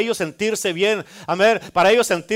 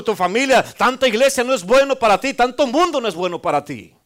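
crowd scolding, higher and louder; hushing crowd; 5 semitones higher, 5 dB louder than usual. Retold or imitated. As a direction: hush him down like a disapproving crowd. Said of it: "a quererlo callar y dice".

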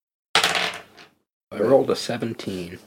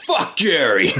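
a playful, higher-pitched yell; in-character yell; 5 semitones higher, slower than usual. Acted. Player shouting at two passing guards, in-character yell. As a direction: yell it as a quick mock outburst.

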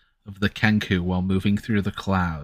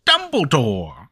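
Dumbledore shout; 2 semitones higher, slower than usual. Shown as a demonstration. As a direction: pop up and announce it. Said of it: "you literally have to pop up and go".

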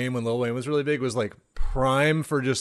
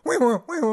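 squeaky wee noises; 11 semitones higher, much faster than usual. Retold or imitated. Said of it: "chair squeaks".

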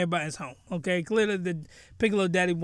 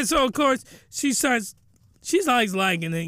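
ordinary talk

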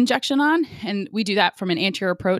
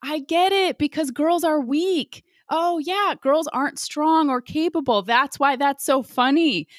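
high, slow, dripping sarcasm; exaggerated high sarcasm; sarcastic mock amusement; 9 semitones higher than usual. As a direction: play along with heavy irony. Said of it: "was like".

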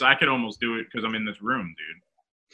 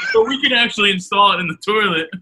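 laughing; 5 semitones higher, 4 dB louder than usual. Real, not acted. Laughs mid pitch.

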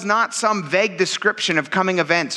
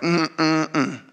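stern Jesus rebuke; 6 semitones lower, slower than usual. Retold or imitated. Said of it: "Jesus is like".

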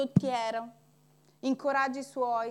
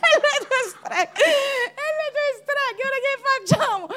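laughing mock panic; 12 semitones higher, 5 dB louder than usual. Church members blaming the pastor, high laughing complaint. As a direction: whine it through laughter. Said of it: "e voi dite".

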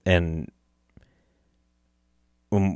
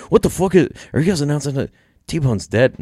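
imitating shocked listeners, pitched up; loud, high mock outrage at the news; alarmed disbelief; 8 semitones higher, 3 dB louder than usual. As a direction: act out a startled demand for answers. Voicing alarmed listeners' reaction.

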